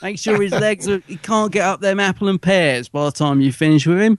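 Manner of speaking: Cockney hard man giving menacing orders; mock Cockney gangster. Ordering his lads to rough up the reviewer, mock Cockney gangster.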